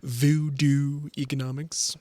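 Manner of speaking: drawn-out Voodoo economics, both words spelled out slowly; slow, savoring. Real, not acted. Host slowly savors the phrase Voodoo economics. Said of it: "something D O O economics".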